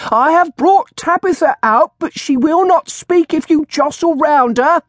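very high, loud crone voice; shrill old woman. A narrator's reading. Old woman with a bird addressing the crowd, shrill. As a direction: screech it out like a street hawker. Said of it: "the old woman called in shrill tones".

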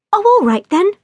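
willing agreement. A narrator's reading. Sophia agrees to go shooting with Jack, willingly. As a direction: give in willingly. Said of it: "willingly enough".

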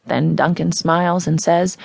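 ordinary delivery, nothing marked

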